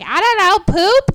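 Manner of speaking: clueless guesser. Retold or imitated. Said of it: "Everybody else is like".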